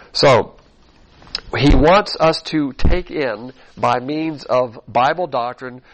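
ordinary talk

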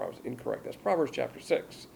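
normal talking voice

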